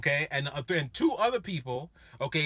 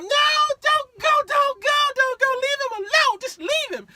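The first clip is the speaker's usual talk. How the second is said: very high, loud frantic woman's plea; girlfriend pleading; 7 dB louder than usual. Retold or imitated. Girlfriend grabbing boyfriend's arm, frantic high pleading. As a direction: beg him high and frantic not to go.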